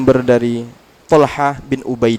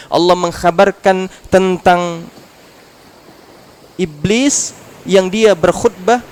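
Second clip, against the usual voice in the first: slow emphasis; 5 semitones higher, 4 dB louder, much slower than usual. Acted. Drawn out for emphasis.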